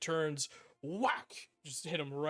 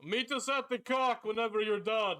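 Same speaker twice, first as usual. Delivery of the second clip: guard calling out; 6 semitones higher, 4 dB louder than usual. Acted. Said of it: "the other two guards just go".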